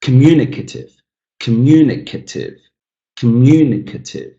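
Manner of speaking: "communicative" with a long, punched MU; teacher modelling "communicative", second syllable strongest; stress on second syllable. Shown as a demonstration. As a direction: hit MU in "communicative". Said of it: "and the second one is stressed".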